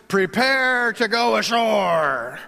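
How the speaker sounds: slow, loud sailor's shout. Shown as a demonstration. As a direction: call it out like a deckhand. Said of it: "Let's say that in your best sailor voice".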